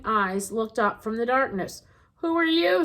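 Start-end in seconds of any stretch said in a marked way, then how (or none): 2.2-2.8 high, wary voice from a hole; a startled little animal asking; small creature voice. Acted. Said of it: "said a voice".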